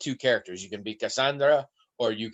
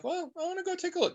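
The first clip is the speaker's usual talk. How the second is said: mock high voice; 6 dB quieter, faster than usual. Retold own thought, high voice.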